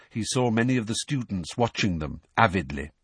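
plain reading voice

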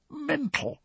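high, hushed, croaked; croaky whisper. A narrator's reading. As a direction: croak the one word faintly. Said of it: "he said in a croaky whisper".

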